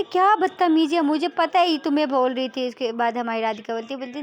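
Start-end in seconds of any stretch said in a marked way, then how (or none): 0.0-2.7 raised, louder retort; irritated and insistent; indignant father. Acted. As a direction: cut in with parental outrage. Father still angry on call.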